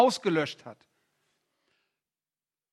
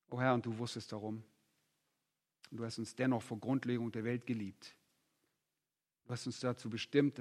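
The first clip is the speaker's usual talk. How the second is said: quiet, earnest prayer voice; hushed pulpit prayer; 7 semitones lower, 4 dB quieter than usual. Acted. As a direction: pray softly for the congregation. Leads closing prayer.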